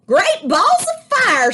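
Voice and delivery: very high, loud, slow exclamation; a brash goose hollers; goose crying out. A narrator's reading. Goose sees the nest and hollers high and loud. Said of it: "she cried".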